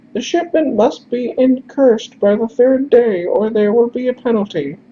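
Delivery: falsetto, recited almost like a mantra; high-pitched recital. Acted. Messenger recites his message to stranger, high-pitched recital. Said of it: "recites the message almost like a mantra".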